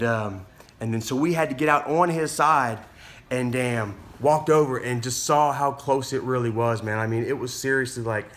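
normal talking voice

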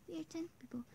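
hushed whisper. Real, not acted. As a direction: whisper it to yourself. Counting players under breath.

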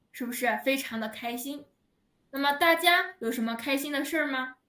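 ordinary talk

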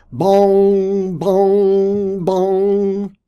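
loud, high, sustained bongs on one note; imitated bell tolling. A narrator's reading. Church bell rings under the nest, loud ringing bongs. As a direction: toll it out like a big bell.

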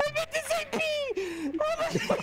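high squealing laughter through words; laughing. Real, not acted. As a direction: laugh while choking out words. Laughing fit after slip.

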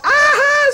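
high, loud pained shriek of a scalded wolf; wolf's scream. Acted. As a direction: shriek out in pain. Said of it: "said the wolf".

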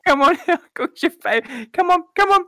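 high, sing-song coaxing; coaxing mom voice. Acted. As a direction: lure the kids to the table. Mother calling boys to dinner.

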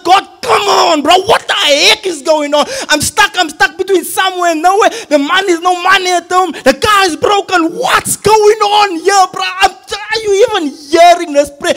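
screaming to God. Retold or imitated. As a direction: re-enact shouting your protest up at God. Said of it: "I start screaming to God".

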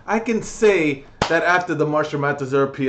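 normal talking voice

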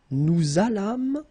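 "nous allâmes" as nou-zalam, slow and high; liaison. Shown as a demonstration. Teacher showing learners the link, liaison. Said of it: "make this little link between the two".

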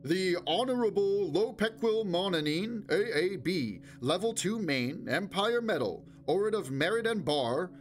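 amplified announcer. A narrator's reading. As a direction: ring it round a vast hall. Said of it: "whose amplified voice rang round".

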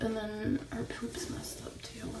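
hushed, slowed whisper. Real, not acted. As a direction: drop to a whisper. Quiet aside about sick kitten.

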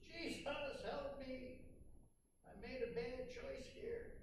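a light hint of a sinking man calling out; mild mock cry for help. Peter sinking, calling to Jesus, a mild mock plea. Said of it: "he kind of yells to Jesus".

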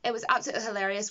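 normal talking voice

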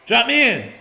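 loud, high battle call; eager shout. Real, not acted. Calling out to start mission.

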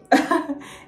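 short high giggle; embarrassed laugh. Real, not acted. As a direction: giggle at yourself. Embarrassed about her Portuguese.

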